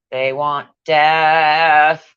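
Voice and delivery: very slow, drawn-out doom voice; slow ominous intoning. Pretend. Mocking the witches, slow dramatic doom. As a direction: toll each word like a prophecy.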